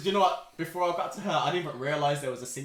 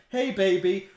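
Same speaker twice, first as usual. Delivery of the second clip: put-on "Hey, baby." lifted high and louder, sing-song; louder, higher hey baby; 6 semitones higher, 9 dB louder, slower than usual. Talking to the baby bump, loud and high. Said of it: "I rubbed her belly and I said".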